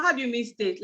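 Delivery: normal talking voice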